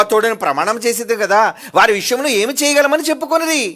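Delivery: reading the verses loud and high; loud scripture reading. Acted. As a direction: proclaim the verses. (బిగ్గరగా వాక్య పఠనం)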